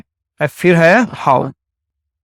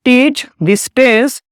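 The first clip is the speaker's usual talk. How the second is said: very slow, each word spaced for the class; slow model sentence; 3 semitones higher, much slower than usual. Shown as a demonstration. Teacher slowly modelling a sentence for students. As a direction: spell it out slowly so students can follow.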